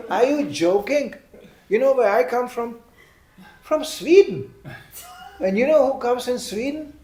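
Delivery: mock outrage at the question; rising incredulous rant; worked-up retort. Speaker retells his reply, heated.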